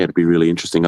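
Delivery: usual speaking voice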